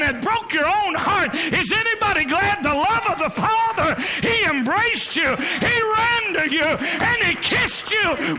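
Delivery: swept up, can't stay calm; getting worked up. Real, not acted. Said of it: "I'm trying to stay calm".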